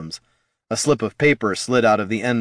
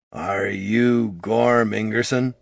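gravelly disguised voice; much slower than usual. Acted. Hooded informant questioning Gorm in the alley, slow gravelly voice. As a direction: growl it slowly like a shady informant. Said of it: "he asked in a gravelly voice".

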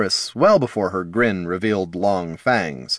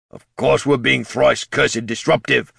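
put-on gruff old ghoul, amused; chuckling ghoul; 4 semitones higher than usual. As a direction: chuckle it sardonically. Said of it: "said Lord Hulch with a chuckle".

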